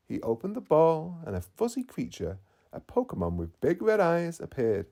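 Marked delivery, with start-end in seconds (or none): none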